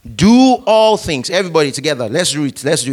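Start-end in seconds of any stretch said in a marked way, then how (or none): 0.0-1.3 slow loud recitation; loud group reading. Shown as a demonstration. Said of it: "Let's read this together, everybody, out loud".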